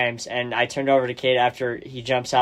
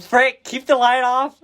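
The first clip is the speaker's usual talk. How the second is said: much higher, slower, comic panicked squeak; hammed-up panic; 10 semitones higher than usual. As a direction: squeak it out in mock panic, like a scared viewer in the dark. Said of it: "You're just like".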